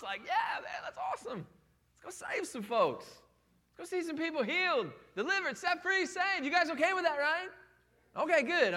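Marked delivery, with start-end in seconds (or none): 0.2-6.4 much higher, bursting with excitement; super pumped. Pretend. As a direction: cheer like you can't wait to go. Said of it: "we should be super pumped".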